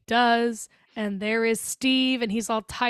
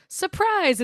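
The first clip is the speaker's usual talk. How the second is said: high-pitched sudden "ta-da" delivery; spring the twist playfully; mock surprise reveal; 9 semitones higher than usual.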